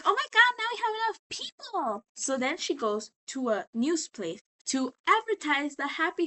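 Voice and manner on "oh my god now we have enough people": much higher, excited squeal; hammed-up giddy Charlie; mock squeal. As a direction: gush like she just won. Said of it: "she's like".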